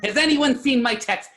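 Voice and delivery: high, loud, slow imitation of the frantic customer; mock screaming. Teasing the clerk by mimicking the customer, mock screaming. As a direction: scream it in a hammed-up old-man voice. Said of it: "I started screaming in the store".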